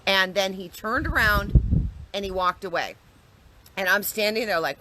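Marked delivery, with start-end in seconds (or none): none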